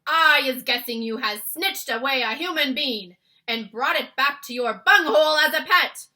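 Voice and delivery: loud booming giant voice. Acted. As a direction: boom it out like a brute giant. Bloodbottler giant voice.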